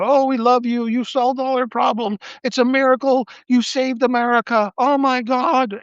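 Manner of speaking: high, gushing sycophant voice; fawning aides. Retold or imitated. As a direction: fawn over the boss, hammed-up. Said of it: "They'll have to go".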